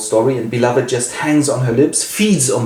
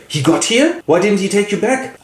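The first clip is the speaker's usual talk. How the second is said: startled Paul; 10 semitones higher than usual. Acted. Paul voiced in alarm.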